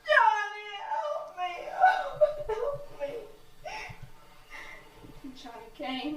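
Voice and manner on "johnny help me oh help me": high, desperate cries for her brother; terrified screaming. Retold or imitated. Woman grabbed at the grave, screaming to her brother for help. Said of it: "And I screamed".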